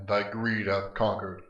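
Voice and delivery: ordinary talk, nothing marked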